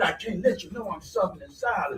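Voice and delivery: a hint of a proud inner voice; softly voicing the proud ego, lightly; ego's voice. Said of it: "So my ego went".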